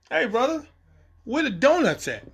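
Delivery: high, playful voice calling for donuts; playful ask. Retold or imitated. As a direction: call out to the crafty guy like a cheeky cop. Asking crafty guy for donuts.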